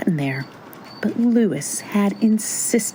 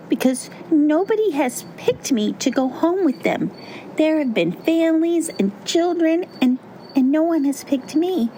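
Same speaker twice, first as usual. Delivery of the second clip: high-pitched, earnest lament; high, longing storybook pumpkin; pleading little pumpkin; 9 semitones higher than usual. A narrator's reading. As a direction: pour it out in a high small-character voice. Pumpkin laments never being picked.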